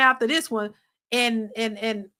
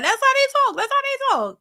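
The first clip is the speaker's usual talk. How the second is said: much higher, repeated insistence; emphatic insistence; 13 semitones higher, 4 dB louder than usual. Real, not acted. Host defending women who talk like that, high insistence. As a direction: press the point twice, high up.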